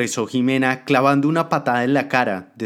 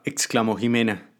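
ordinary delivery

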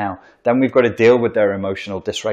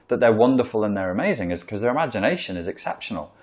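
ordinary talk, nothing marked

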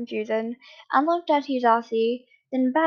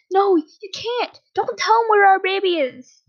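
frantic plea; 9 semitones higher, 6 dB louder than usual. A narrator's reading. Hizashi begging husband not to tell where baby is, frantic. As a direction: plead loudly, pitch pushed way up. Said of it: "he frantically shook my arm".

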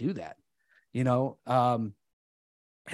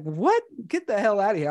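stunned disbelief; 7 semitones higher, 4 dB louder than usual. Retold or imitated. Girlfriend's stunned laughing reaction.

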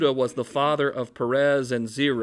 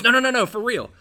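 high, loud no no no for real; a pleading shout to be believed; mock outraged insistence; insistent protest; 9 semitones higher, 9 dB louder, faster than usual. Servant insisting to businessman.

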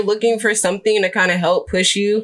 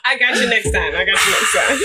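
laughing. Real, not acted.

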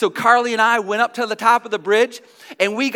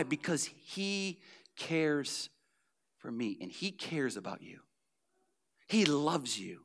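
tender; 6 semitones lower, 16 dB quieter, slower than usual. Acted. Tender close of sermon point.

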